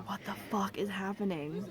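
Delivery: whispered, bewildered inner thought; hushed, acted-out shock; stunned whisper. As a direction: whisper it in disbelief, as if thinking aloud. Retold stunned thought after creepy call.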